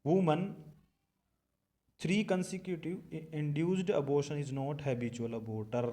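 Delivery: very slow, word by word for note-taking; dictating a definition at a crawl; slow dictation. Shown as a demonstration. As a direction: read it out slowly so the class can copy it. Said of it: "यहाँ लिख लो".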